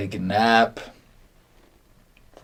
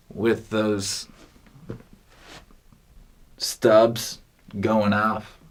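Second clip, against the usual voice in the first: ordinary talk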